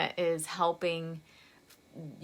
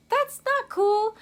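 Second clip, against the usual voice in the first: indignant; 14 semitones higher, 6 dB louder than usual. Real, not acted. Indignant about client hung up on.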